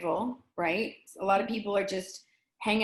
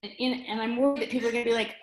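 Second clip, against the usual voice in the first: normal talking voice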